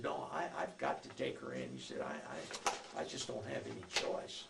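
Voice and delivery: a hint of a regretful lawman; officer voice. Officer explains arrest to son.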